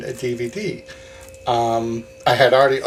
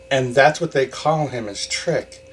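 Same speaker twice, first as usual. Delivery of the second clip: normal talking voice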